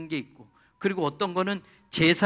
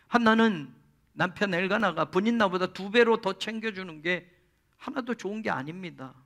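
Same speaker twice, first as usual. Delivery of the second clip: ordinary talk, nothing marked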